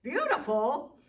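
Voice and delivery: high, loud puzzled question; loud astonished repeat; surprised exclaim. Acted. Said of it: "the giants exclaimed".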